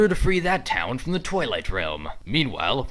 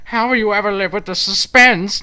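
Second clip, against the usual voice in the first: higher, louder gloating villain voice; mocking taunt; 7 semitones higher, 8 dB louder than usual. Villain gloats at viewers about the cut-off scene, high mocking voice. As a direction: rub the suspense in their faces.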